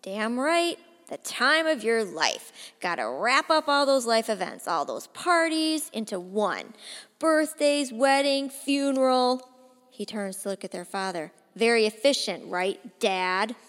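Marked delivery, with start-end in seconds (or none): 0.0-9.5 louder, rising mocking tirade; bitter rant. Drunk brother's bitter rant. 11.5-13.6 loud, mocking jab at Dad; taunting. Taunting father.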